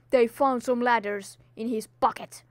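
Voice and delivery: ordinary talk, nothing marked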